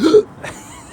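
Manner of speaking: sharp high gasp at the cold air. Real, not acted. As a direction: gasp in suddenly. Cold dry air outside.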